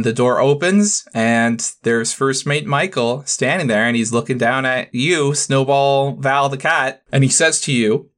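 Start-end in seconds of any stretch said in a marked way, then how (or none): none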